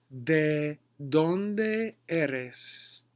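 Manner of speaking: ¿De dónde eres? stretched out very slowly, each word drawn long. Shown as a demonstration. Teacher repeats the question very slowly for a confused student. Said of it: "Can you say that slower?".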